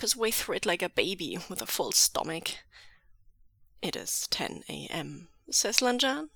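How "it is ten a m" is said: low, soft and unruffled; serene. A narrator's reading. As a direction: state it calmly, low and quiet.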